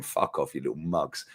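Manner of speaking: an unvoiced whisper, dismissive and contemptuous; muttered insult. A fan mutters an insult at the rival club, whispered. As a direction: hiss it under your breath at the rivals. Mocking rival fans aside.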